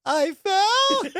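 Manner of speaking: very high, stretched disbelief; incredulous. Retold or imitated. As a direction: imitate her squeaky echo from behind. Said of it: "With this tone".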